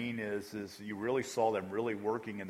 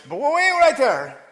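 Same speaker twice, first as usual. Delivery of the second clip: high, loud, slow Bermudian "wait right there"; imitating Burton again, drawn out and high; Bermudian accent; 15 dB louder, slower than usual. Burton stops the kid in a high, loud Bermudian accent. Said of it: "Is that a good accent".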